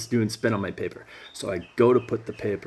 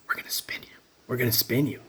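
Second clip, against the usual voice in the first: eerie whisper; 3 semitones higher, faster than usual. Retold or imitated. Voices in his head whispering.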